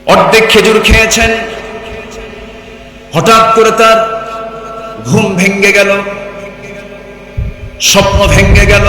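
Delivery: high, loud bursts with dramatic pauses; full-force preaching. Acted. Preacher telling of the sudden waking, full-force preaching. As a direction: proclaim each phrase loudly, pitched high.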